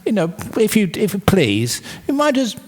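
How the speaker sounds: high, gushing mock-polite pleading; sweet coaxing. Farmer sweetly begs his ox to pull the carts. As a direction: sweet-talk the ox like a nervous suitor. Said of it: "because of those kind words".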